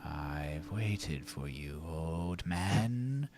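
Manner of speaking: slow, low, hushed ghost; eerie, quiet menace; spectral voice. Acted. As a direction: draw it out softly, like a lurking spirit. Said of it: "you hear the voice come over and say".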